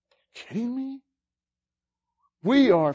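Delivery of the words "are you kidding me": incredulous. Real, not acted. Preacher marvelling at grace.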